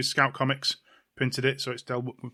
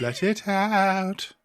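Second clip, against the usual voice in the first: slow, higher little-voice echo; mimicking a little voice; 7 semitones higher, 4 dB louder, much slower than usual. Host mimics co-host's little voice, slow and higher. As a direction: stretch it out in a tiny put-on voice. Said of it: "little voice".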